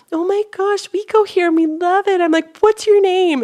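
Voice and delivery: high, eager gush. Retold or imitated. Telling the newcomers they love the church, high gushing. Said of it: "And I'm like".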